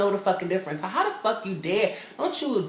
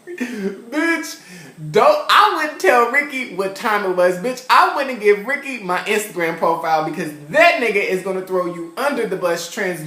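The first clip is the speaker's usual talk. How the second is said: sassy rant; 6 dB louder than usual. Real, not acted. Sassy rant about Ricky.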